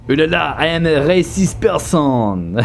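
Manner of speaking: high, hammed-up French accent. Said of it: "when I do my French accent".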